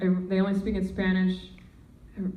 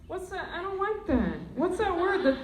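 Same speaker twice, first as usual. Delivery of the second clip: ordinary delivery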